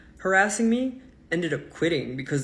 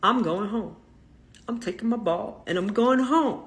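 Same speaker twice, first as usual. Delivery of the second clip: retold lost temper; 6 semitones higher than usual. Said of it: "Till I lost my temper".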